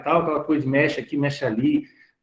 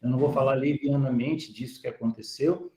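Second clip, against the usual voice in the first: sombre, lowered tone; 3 semitones lower, 6 dB quieter than usual. Real, not acted. Speaker turning to a recent tragedy, sombre and hushed. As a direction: lower and softer, grave and subdued. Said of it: "vou mudar o tom da, e a entonação da minha fala".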